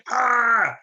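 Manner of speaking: high held "ah" scream, mock outtake style; a long, high mock scream; imitated scream. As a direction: mimic a film character screaming. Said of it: "characters shouting or screaming".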